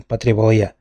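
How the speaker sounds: plain reading voice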